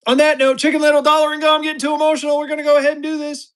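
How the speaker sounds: high, loud hype-man burst; excited hype. Real, not acted. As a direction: fire up the chat for the giveaway. Hyped giveaway launch on stream.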